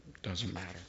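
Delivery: dropped almost to a breath; a quiet repeat to let the point sink in; hushed echo. Shown as a demonstration. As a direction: let it fall away softly. Soft echo of own line.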